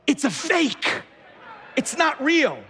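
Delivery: high, emphatic spelling-out; exasperated explaining. Real, not acted. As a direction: break it to them as if they just won't get it. Mock-explains knockoffs to congregation.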